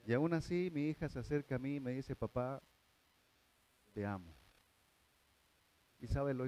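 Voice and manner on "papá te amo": soft, hushed daughter's words; tender papá te amo. Retold or imitated. Preacher softly quoting his daughter's love. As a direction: say it gently, like a child to her father.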